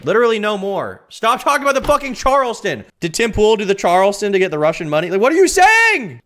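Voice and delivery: high-pitched yelling, peaking at the end; exasperated rant. Real, not acted. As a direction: rant at chat, completely fed up. Ranting at chat's Charleston jokes.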